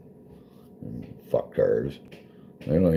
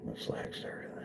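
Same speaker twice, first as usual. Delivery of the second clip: whispered aside; whispering; 19 dB quieter than usual. Real, not acted. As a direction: whisper it off-mic. Aside under breath.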